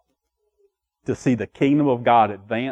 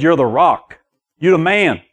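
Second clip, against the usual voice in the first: mildly hyped acted praise, lifting higher at the end; hearty praise; 4 semitones higher, 4 dB louder than usual. Preacher voicing Jesus praising Peter, hearty praise. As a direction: build up to a punched compliment.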